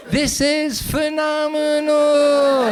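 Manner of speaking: very high, slow, loud hammed-up cry; shrieking mock climax; mock ecstatic shout. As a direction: belt it out like an over-the-top lover. Too many syllables example.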